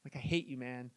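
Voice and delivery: low, soft deflated grumble; joking defeat, trailing right off; mock resentment. Man answering friend's unwelcome vision, quietly mock-resentful.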